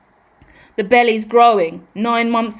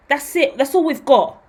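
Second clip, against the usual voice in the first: ordinary delivery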